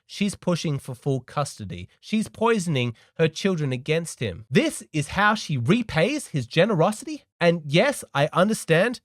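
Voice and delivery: ordinary delivery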